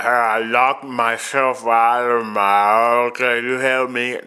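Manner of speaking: bum voice. Retold or imitated. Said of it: "ringing my doorbell saying".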